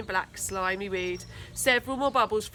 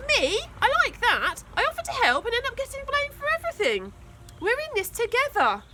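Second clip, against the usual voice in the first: put-on much higher, fast indignant girl voice; 14 semitones higher than usual. A narrator's reading. Tina protesting to Trevor, high fast and indignant. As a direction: protest at the unfair blame.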